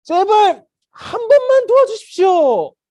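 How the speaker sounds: loud, high, drawn-out pleading cry; desperate begging. Acted. Beggar pleading with people at the temple, desperate begging. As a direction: wail out the plea at full voice. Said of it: "이렇게 해가지고 막 구걸을 했단".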